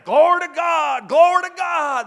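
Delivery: loud, high shouted praise; old man shouting praise. Retold or imitated. Old man in a country church shouting praise. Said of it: "And this old man".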